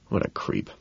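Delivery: very soft, voiceless aside; muttered. A narrator's reading. Man looking back at his rival, muttering. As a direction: mutter it under the breath. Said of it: "I mutter".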